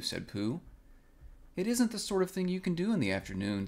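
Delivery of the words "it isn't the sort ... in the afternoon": fast and high-pitched; said quickly. A narrator's reading. Piglet hurriedly refuses Pooh's offer to come along, quickly. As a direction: rush it out, higher, to head off the offer. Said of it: "said Piglet quickly".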